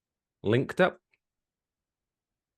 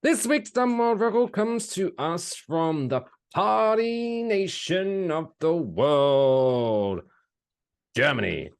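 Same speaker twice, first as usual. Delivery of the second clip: slow, higher show-host announcement; announcer voice; 7 semitones higher, slower than usual. Acted. Host introducing the record to listeners, drawn-out announcer read. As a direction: present it like a grand segment. Presenting the segment.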